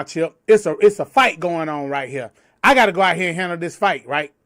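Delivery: ordinary delivery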